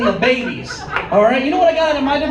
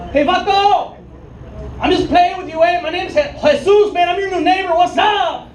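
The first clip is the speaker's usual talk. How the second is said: higher, friendly mock yell with a Chicano lilt; 6 semitones higher than usual.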